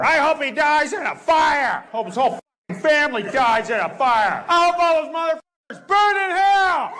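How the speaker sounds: high furious shouted rant; hateful rant. Retold or imitated. Trump haters cursing him, high mock furious shout. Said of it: "talk like this".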